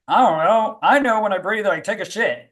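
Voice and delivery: a hint of a gruff champ shrugging it off; Mike Tyson impression. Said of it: "And he was like".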